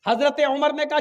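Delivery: ordinary talk, nothing marked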